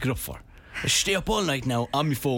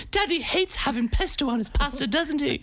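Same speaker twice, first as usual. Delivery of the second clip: put-on high little kid telling tales; sing-song tattling toddler; playing his future child teasing about Daddy, high kid voice; 11 semitones higher than usual. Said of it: "I go".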